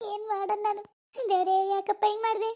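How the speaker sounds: soft, despairing plea to God; despairing sigh. Acted. Mother alone, lamenting her daughter's deed, soft and despairing. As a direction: quietly wring your hands over it.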